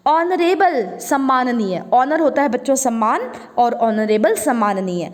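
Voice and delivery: usual speaking voice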